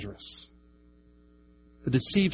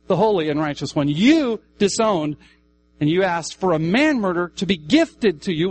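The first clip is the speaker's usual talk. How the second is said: high, loud emphatic preaching; preaching at full force; 7 semitones higher, 7 dB louder than usual. Acted. Preacher pressing the crowd with loud high yous. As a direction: hammer each you, voice raised high.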